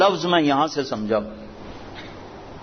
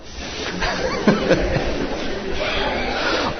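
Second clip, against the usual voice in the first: soft breathy laugh; 4 semitones higher, 4 dB quieter than usual. Real, not acted. Laughing at the trapped partridges.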